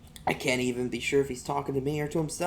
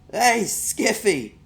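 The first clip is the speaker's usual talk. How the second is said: high, slow, drawn-out jeer; taunting bully; 11 semitones higher, 7 dB louder, much slower than usual. Acted. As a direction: call out to mock him. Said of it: "popping a wheelie and showing off".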